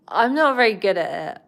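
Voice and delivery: ordinary delivery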